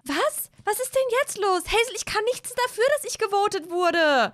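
high, startled protest; upset self-defence. Acted. Olivia defends herself to Hazel, protesting.

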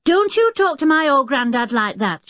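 put-on high, angry boy's shout; indignant high-pitched outburst. A narrator's reading. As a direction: jump in loudly to defend someone. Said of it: "shouted the boy".

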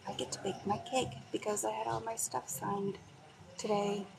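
normal talking voice

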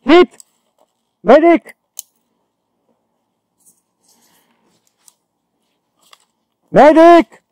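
loud high call for a medic; man down shout. Real, not acted. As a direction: yell hit, call the medic. Hit in game, calling medic.

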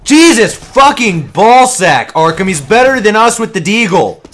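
exasperated yelling. Real, not acted. Gamer griping to teammates about a better player, yelling. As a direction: yell it in real disbelief at the enemy's aim. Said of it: "Now stop yelling".

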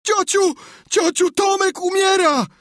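very high, loud screaming shout; panicked yelling. A narrator's reading. Sid races downstairs to aunt, panicked high shout. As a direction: yell for help at full pitch. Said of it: "wrzeszczał".

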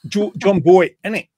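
loud East End London accent, dropped T. Shown as a demonstration. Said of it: "said it in an East End accent".

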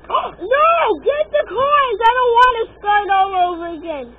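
high, loud, fast panicked cries; panicked shouting. Real, not acted. Panicking about coins.

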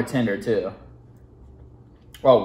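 talking while chewing; speaking between bites; mouth full. Real, not acted. Seen 0: hand brings food to mouth, then speaks while chewing.

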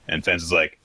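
ordinary delivery

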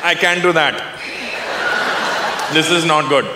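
put-on Indian voice, long deliberate pauses; Indian negotiator. Raj proposes a deal to owner, deliberate Indian negotiator. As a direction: play a calmly scheming Indian businessman. Acted Indian worker bargains back.

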